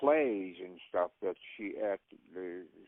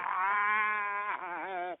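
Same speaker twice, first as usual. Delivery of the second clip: shouted laugh; 9 semitones higher than usual. Real, not acted. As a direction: burst out laughing. Laughing after line.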